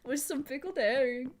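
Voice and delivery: hammed-up Swedish shopkeeper; a hint of a Swedish accent; mock Swedish accent. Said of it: "I can't really do a Swedish accent".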